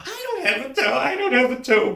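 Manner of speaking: high-pitched pleading; frightened boy. Acted. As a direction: plead in a small, high, panicked voice. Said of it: "the boy says".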